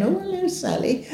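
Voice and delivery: high, sing-song hello from a friendly receptionist; cheery receptionist greeting. Retold or imitated.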